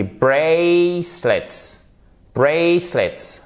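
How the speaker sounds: slow "bracelets" with a long stressed BRAY; first-syllable stress. Shown as a demonstration. Teacher drills "bracelets", stress on first syllable. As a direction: stretch the first syllable of "bracelets" as "ay", drop the second. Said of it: "vous avez l'accentuation ici sur la première syllabe".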